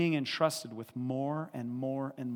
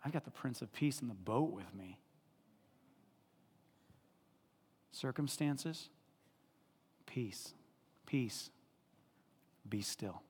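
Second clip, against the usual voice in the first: softer, settled and calm; calm inflection; 8 dB quieter than usual. Shown as a demonstration. Preacher turns to circumstances, calm soft speech. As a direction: speak peace over the storm gently. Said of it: "my inflection changes".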